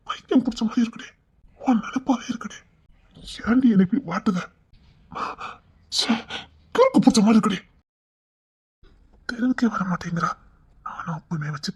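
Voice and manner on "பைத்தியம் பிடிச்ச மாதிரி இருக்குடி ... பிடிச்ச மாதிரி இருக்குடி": lovesick anguish. Acted. Lovesick man's imagined plea.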